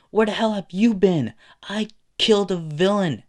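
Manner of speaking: loud retort. Acted. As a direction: fire the question right back at him. Izuku throws the question back.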